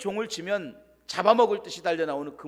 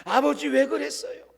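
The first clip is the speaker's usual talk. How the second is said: tearful outburst; 7 semitones higher than usual. Retold or imitated. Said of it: "쿠션을 때리고 눈물을 흘리고".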